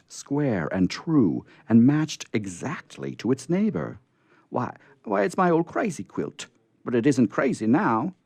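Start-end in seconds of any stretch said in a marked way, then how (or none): 4.5-8.1 high, astonished exclamation; amazed cry. A narrator's reading. Aunt finds her remade quilt, high amazed cry. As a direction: gasp it out in delighted surprise. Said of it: "cried Aunt Hannah".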